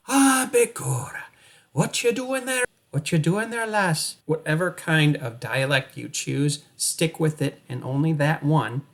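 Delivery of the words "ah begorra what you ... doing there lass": high-pitched stage-Irish brogue; hammed-up Irish greeting; Irish accent. Shown as a demonstration. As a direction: play a comic Irishman, pitched up. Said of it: "or Irish".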